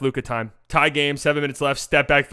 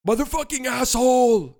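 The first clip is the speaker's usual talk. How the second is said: high, drawn-out mock yell; Luka Doncic trash talk; 10 semitones higher than usual. Another guess at what Luka said, slow high yell. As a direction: stretch out the insult, high and slow. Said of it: "What I actually think he's saying".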